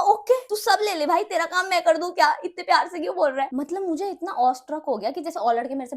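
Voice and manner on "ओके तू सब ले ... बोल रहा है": amazed excitement. Retold or imitated. Amazed at boy's kindness.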